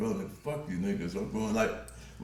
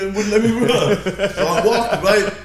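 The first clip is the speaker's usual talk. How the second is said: laughing; 11 semitones higher, 12 dB louder than usual. Real, not acted. Laughing while telling the story.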